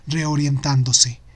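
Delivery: ordinary delivery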